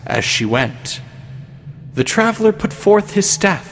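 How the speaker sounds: ordinary delivery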